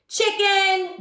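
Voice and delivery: high, loud jeer; taunt. A narrator's reading. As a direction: sneer it like a playground taunt. Darren taunting Charlie.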